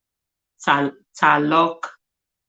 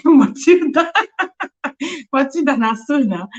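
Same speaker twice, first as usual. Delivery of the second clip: high laughing call of the name; laughing greeting; 8 semitones higher, 3 dB louder than usual. Real, not acted.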